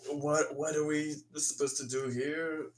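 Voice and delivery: ordinary talk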